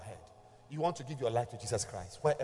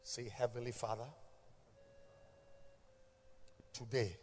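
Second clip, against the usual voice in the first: guided prayer; 2 semitones lower, 8 dB quieter than usual. Acted. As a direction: lead the prayer gently, line by line. Leads the sinner's prayer.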